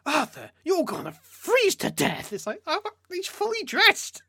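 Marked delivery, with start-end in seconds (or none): 0.0-2.3 high, slow fussing uncle voice; nagging old uncle. Retold or imitated. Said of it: "Uncle keeps saying". 2.7-4.2 high mock retort. Mock reply to game uncle.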